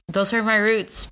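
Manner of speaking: normal talking voice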